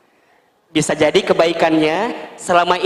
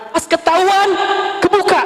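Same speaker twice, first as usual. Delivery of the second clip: high startled exclamation; mock shock; 12 semitones higher than usual. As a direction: gasp it out, high. Voicing wife's discovery. (kaget dengan suara tinggi)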